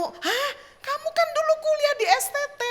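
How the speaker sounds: high-pitched, quick surprised question; startled recognition. Retold or imitated. Acted startled reply on phone.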